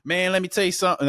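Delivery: hammed-up Southern accent imitation. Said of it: "try my best Southern accent".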